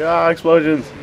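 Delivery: a high, gleeful cry of "explosions"; delighted by the blasts; excited. Real, not acted. Thrilled by game explosions.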